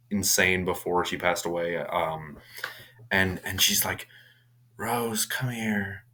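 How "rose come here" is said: slow, drawn-out whisper; eerie whispered summons. Retold or imitated. Mad mother calls her child to her, eerie whisper.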